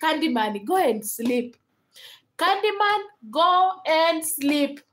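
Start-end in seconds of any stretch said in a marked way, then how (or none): 2.4-4.8 higher and very slow, drawn out; exasperated repeat. Real, not acted. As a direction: stretch each word like a tired parent. Telling a viewer to sleep.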